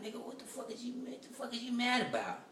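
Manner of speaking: high, incredulous mock retort; exasperated reply. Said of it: "I'm like".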